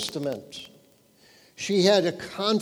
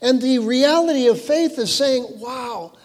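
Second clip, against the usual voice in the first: rising preaching fervor; 5 semitones higher than usual. Acted. Preaching builds louder.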